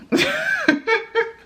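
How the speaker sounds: high giggle of embarrassment; embarrassed laugh. Real, not acted. Embarrassed laugh after confession.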